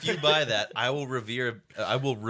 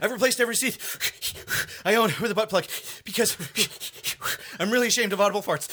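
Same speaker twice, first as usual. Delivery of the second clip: higher, loud straining push; determined pushing; 7 semitones higher, 3 dB louder, faster than usual. Acted. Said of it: "go more for the, uh, determined pushing".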